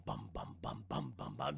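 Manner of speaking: low, soft rhythmic bums; steady mouth-made drum thuds; imitated drumbeats. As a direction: sound out distant drums, deep and quiet. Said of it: "they hear this bum".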